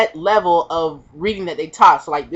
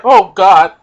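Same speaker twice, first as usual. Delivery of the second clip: panicked gasp; 7 dB louder than usual. Retold or imitated. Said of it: "I was like".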